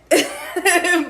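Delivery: a real laugh, high and loud. Real, not acted. Laughs after rain story.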